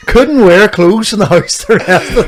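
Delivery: laughing, high and loud; both laughing at the sweltering house. Real, not acted. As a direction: crack up at the memory. Laughing at heat story.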